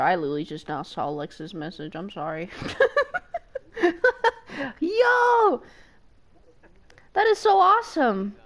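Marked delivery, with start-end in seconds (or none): 2.6-4.8 giddy high laugh; high laughing squeal. Real, not acted. Laughing at news. 4.8-8.4 loud, high thrilled yell; excited shout. Real, not acted. Shouting at good news.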